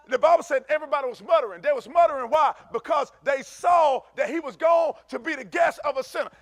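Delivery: loud, high preaching. Acted. Preaching on the muttering crowd.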